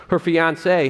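ordinary delivery

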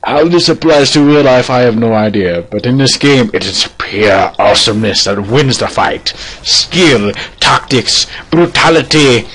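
mock-epic, rousing declamation; lofty master character. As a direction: build to a grand battle slogan. Master's speech goes on.

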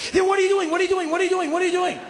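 high-pitched rapid-fire demand, over and over; panicked, insistent repetition with no breathing room; frantic questioning. Retold or imitated. Homeowner confronting a burglar, frantic and high.

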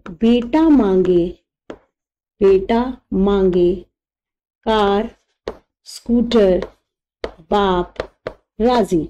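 slow, word by word; dictating the mnemonic to students; slow dictation. Shown as a demonstration. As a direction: drop each word in with pauses. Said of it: "मैं बहुत आराम से बोल रही हूँ".

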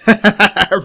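short, loud, high burst of laughter; gleeful laugh. Real, not acted. After the angry player rage quits, a loud triumphant laugh.

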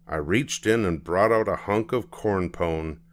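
usual speaking voice

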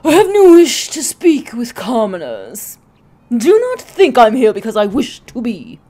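loud, haughty, droll student voice; snooty. Acted. Transfer student refusing to speak with commoners, snooty and droll. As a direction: look down your nose at the commoners. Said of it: "get to make the snooty face".

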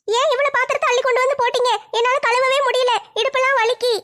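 younger sister voice. Acted. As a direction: pitch up as the weary little sister.

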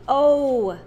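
rope called out loud and clear; emphatic model word. Shown as a demonstration. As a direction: rope hard en langgerekt. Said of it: "escucho el sonido".

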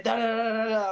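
high-pitched mock lecture in nonsense syllables; ranting history lesson. Boxer mimicking Irish guys giving a history lesson, high babbled rant. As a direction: rattle off da-da-da as an imitated rant. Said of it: "they'll go into history".

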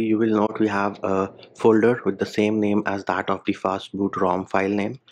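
ordinary talk

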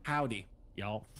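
put-on Texas accent. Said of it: "you're allowed to do that accent".

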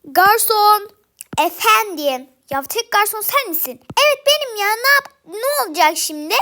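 loud shout across the room. Acted. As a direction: yell for the waiter. Customer calling out for the waiter. (bağırarak)

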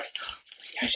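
breathless whisper of delight; whispered oh my gosh. Real, not acted. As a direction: whisper it as if the gift is too cute to say aloud. Opening a bracelet, whispered delight.

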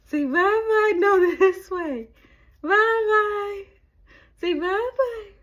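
excited high coaxing with laughs. Real, not acted.

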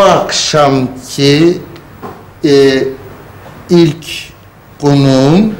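slow halting delivery. Shown as a demonstration. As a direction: say it slowly, word by word. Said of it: "yavaş yavaş söylüyorsun".

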